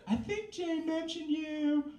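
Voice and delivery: high, slow, hammed-up flirty voice. Woman on the phone to a new man, mock flirty and high. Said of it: "your best flirty voice".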